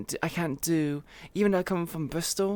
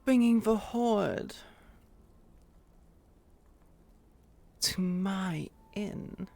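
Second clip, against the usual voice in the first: slow posh British innkeeper, bringing weighted; slower than usual. Acted. Innkeeper scolding the guest in slow posh British. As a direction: draw it out like an affronted English innkeeper. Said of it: "It sounds far more posh".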